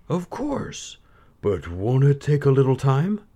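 a hint of a puzzled sultan. A narrator's reading. The Sultan replies.

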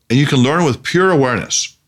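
ordinary delivery

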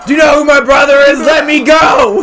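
high, fast, loud entitled shout; drunken posh sneer. Acted. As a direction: yell it like a spoiled drunk snob. Mock entitled arrest protest.

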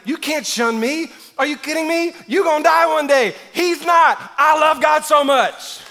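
high, loud, fervent shouting; defiant attitude. Acted. David refusing to be shamed for worship, defiantly shouting. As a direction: throw it back and build to a roar. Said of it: "I love David's attitude. He's like".

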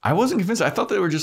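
high, lightly squeaked protest; mock indignant. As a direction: squeak it defensively.

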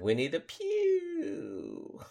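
very slow drawn-out punchline. Acted. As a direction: stretch the punchline out slowly. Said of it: "the delivery is top notch".

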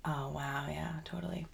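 normal talking voice

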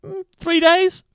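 high, slow, rising mock estimate; imitating co-host thinking aloud; mock pondering co-host. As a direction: draw it out high, as if weighing it up. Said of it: "that is just you".

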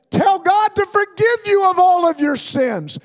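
put-on high, loud plea from an old saint; hint of a fervent old lady pressing a child; old church lady's urging. Elderly church lady urges a ten-year-old to repent, high and loud. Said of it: "she said".

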